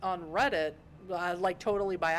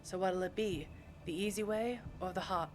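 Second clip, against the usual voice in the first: put-on tentative New York accent; 5 dB quieter than usual. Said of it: "I can try to do a New York accent".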